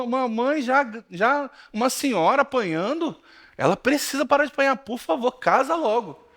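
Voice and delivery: high, pleading mock earnest pastor; pastor's joking manner. Pastor urging the girl to marry to save the mother, high mock urgency. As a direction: play the pastor begging her to marry, tongue in cheek. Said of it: "Naquele jeitão dele lá".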